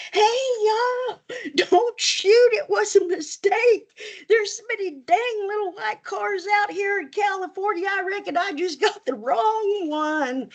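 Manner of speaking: put-on high hollered hillbilly accent, panicked. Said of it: "I hollered out my best hillbilly accent".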